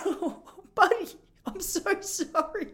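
high, slow, exaggerated consoling; mock sympathy. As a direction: coo an over-the-top apology to a small child. Teacher consoling an upset child.

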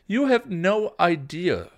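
loud, high, emphatic reprimand; heated telling-off; scolding. A narrator's reading. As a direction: scold a reckless younger sister. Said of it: "Buffy scolded".

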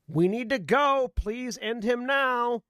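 urgent plea. Acted. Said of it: "by just, but from the".

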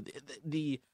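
normal talking voice

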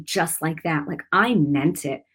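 usual speaking voice

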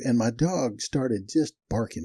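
usual speaking voice

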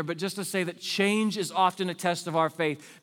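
ordinary talk, nothing marked

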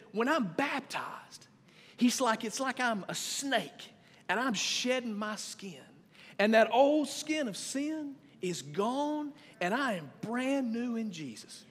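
a dad imitating his son's earnest explanation. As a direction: lay it out slow like an eager kid explaining. Father voices son's snake explanation.

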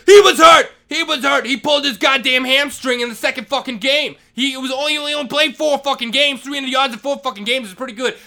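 frantic defensive yell. Retold or imitated. Vice principal defending his injured son, frantic yell. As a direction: shout to defend the kid. Retold shouting match with principal.

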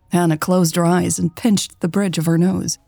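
ordinary delivery, nothing marked

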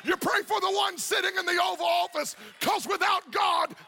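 full-force preaching. Acted. Pastor urging the congregation that God wants everyone saved, full-force preaching. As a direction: preach it out to the whole church.

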